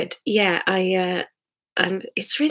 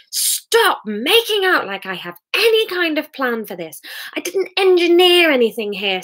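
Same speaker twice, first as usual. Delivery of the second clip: higher, pushed, defensive anger; heated argument; 6 semitones higher than usual. Acted. Hamish defending himself to Sarah, heated. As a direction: argue back hard, voice up. Couple arguing late at night.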